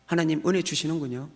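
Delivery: ordinary talk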